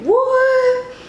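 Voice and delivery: very high-pitched, drawn-out disbelief; retold amazement. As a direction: squeal it in mock astonishment. Amazed that he came so fast.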